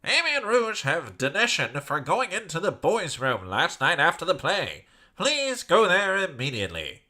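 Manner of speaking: announcer voice. Acted. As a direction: read it like a school PA.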